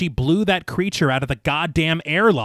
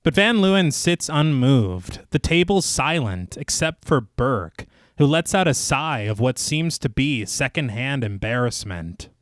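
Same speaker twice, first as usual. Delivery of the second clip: measured, lower narrator's read; story narration; 3 semitones lower than usual. A narrator's reading. Narrator recaps the inquiry scene for listeners, measured storytelling. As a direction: read it like a polished audio storyteller. Reads scripted film recap.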